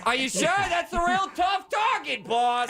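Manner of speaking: high, slow goofy pilot voice; helicopter pilot voice. Acted. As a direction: play the dopey sidekick pilot. Pilot character objects loudly.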